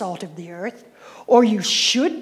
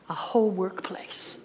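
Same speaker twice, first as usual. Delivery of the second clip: a whole workplace whispered, much softer; 3 semitones lower, 8 dB quieter than usual. Real, not acted. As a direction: drop to a whisper on the last item. List ending in a soft close.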